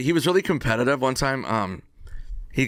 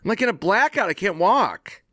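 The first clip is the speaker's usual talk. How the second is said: mock drunk complaint; 9 semitones higher than usual. Drunk after waiting all day.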